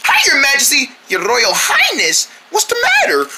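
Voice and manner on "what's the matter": ordinary delivery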